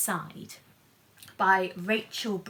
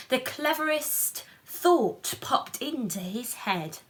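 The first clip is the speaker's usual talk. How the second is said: higher, louder lively read-aloud; storybook narration; 3 semitones higher, 4 dB louder, slower than usual. A narrator's reading. Reading the picture book aloud to children, animated. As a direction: lift the story up for the little ones.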